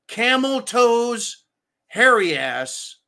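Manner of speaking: "camel toes hairy ass" said slowly for Kamala Harris; deliberate slow mock of the name; mock mispronunciation. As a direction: mangle the name slowly as a jeer. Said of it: "pronounced her name wrong".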